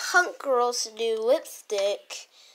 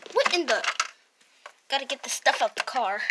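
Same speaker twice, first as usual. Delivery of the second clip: normal talking voice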